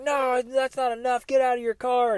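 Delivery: high-pitched mock angry yell; imitated screaming. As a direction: play the irate driver without full volume. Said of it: "and screaming and".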